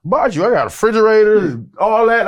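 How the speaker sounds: high, loud, harried calling out; retold hollering. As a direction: imitate shouting over a busy moving job. Acts shouting while busy moving fridges.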